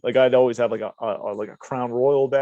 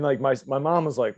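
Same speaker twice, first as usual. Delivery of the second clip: ordinary delivery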